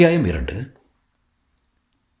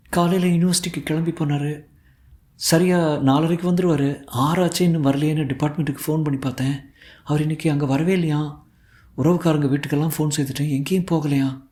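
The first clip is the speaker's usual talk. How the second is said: put-on higher voice of an anxious young woman; lightly tearful, higher girlish voice; worried, tearful daughter; 5 semitones higher than usual. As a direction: fret through the news, pitched up. Tearful daughter reports father missing.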